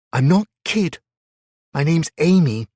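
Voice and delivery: put-on high, loud, stubborn child; stubborn girl. A narrator's reading. Little girl stubbornly insists on her name. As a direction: insist like a cross ten-year-old. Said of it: "she repeated stubbornly".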